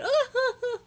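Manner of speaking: high, forced mimicked giggle; fake girly laugh. Teller showing that fake laugh girls have, high and phony. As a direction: put on a loud-pitched phony laugh. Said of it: "that fake laugh girls be having".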